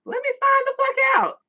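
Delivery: high, loud mock outburst; comic shout at the chat; playful shout. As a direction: yell it in comic disbelief.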